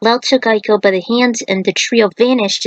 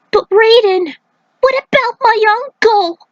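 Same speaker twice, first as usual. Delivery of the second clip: put-on much higher, pleading young voice; worried young voice; 13 semitones higher than usual. Young fighter pleading with Raiden about his uncle, high and worried. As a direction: ask anxiously about your uncle.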